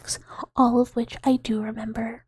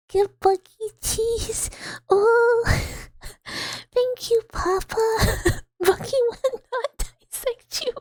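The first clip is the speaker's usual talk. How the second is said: much higher, playful character voice breaking into laughs; 10 semitones higher than usual. Acted. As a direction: keep the high silly voice through giggles. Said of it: "We're getting in character".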